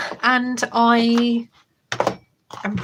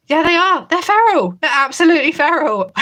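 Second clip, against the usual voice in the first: exasperated laughing; 7 semitones higher, 5 dB louder than usual. Real, not acted.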